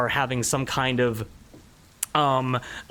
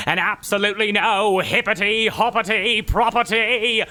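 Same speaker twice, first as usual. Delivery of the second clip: higher, louder troll bossing the guards; 5 semitones higher, 3 dB louder than usual. Acted. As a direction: lay down the rules as a big troll. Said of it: "this troll goes".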